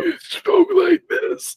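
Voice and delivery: high, slow gasping creepy voice. Shown as a demonstration. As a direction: wheeze it out like a ghoul. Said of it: "like, that gasping voice".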